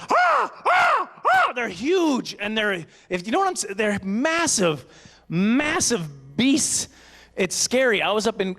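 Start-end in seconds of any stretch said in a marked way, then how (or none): 0.1-2.3 very high, slow, stretched out cry; exaggerated awe, high and drawn out; awestruck. Real, not acted. Describing massive ravens. 3.8-6.9 slow emphasis. Real, not acted. Emphasising giant ravens.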